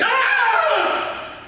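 mock scream, high and drawn out; high-pitched scream. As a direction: imitate a man screaming in panic. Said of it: "And he screamed".

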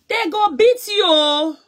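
a rival taunted in a high sing-song. Real, not acted. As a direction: drag it out to taunt her. Taunting rival on live stream.

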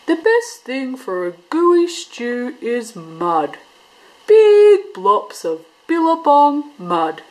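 a fussy platypus relishing the mud; deliberate storybook Platypus; Platypus advice. A narrator's reading. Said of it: "said Platypus".

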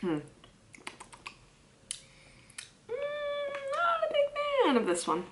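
speaking while tasting food; eating yogurt between bites. Real, not acted. Seen 3: Holds yogurt cup, stirring and lifting spoon between small bites.

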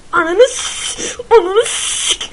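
high, loud, slow cut-off curse; strangled cry. Real, not acted. Gamer stopping a curse mid-word in panic.

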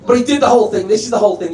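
usual speaking voice

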